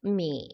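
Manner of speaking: 미 sinking low in third tone. Shown as a demonstration. Teacher reads out the syllable, 미 in low third tone. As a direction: let 미 drop low, drawn out. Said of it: "미음 발음이니까 삼상으로".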